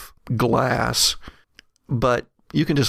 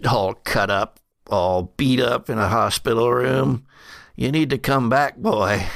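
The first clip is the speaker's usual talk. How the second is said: put-on old, battered brother. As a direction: urge the boy as his aged brother.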